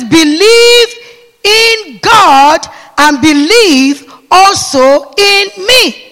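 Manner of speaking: loud, slow preaching; slow thundering scripture; preached scripture. Acted. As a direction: drag each word out from the pulpit. Preacher quoting scripture forcefully.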